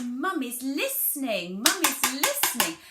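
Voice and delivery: put-on demonstrated mummy-to-child voice. Shown as a demonstration. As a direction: exaggerate each word for a toddler. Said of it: "you could say".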